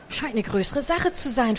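ordinary delivery, nothing marked

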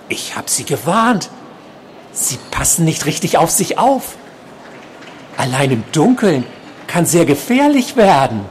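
high-pitched uncanny patient voice; creepy stalker, warning. A narrator's reading. Patient warning the nurse alone in the dark, high creepy voice. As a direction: warn her in a high, insistent voice.

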